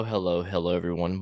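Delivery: normal talking voice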